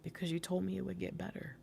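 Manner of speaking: barely audible, soft and subdued; very quiet. Retold or imitated. Partner's quiet answer in the middle of a fight, very soft. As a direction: say it almost under your breath, gently. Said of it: "really quietly".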